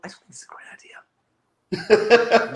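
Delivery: breathy laughing whisper. Retold or imitated. Deadpool whispering to Cillian Murphy, laughing. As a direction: whisper it like a sly aside. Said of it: "trying to convince Cillian Murphy".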